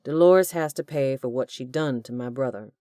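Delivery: loud, vengeful villain; vengeful demand. A narrator's reading. Hugh demanding Dolores pay for his brother, loud and vengeful. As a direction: demand payback coldly.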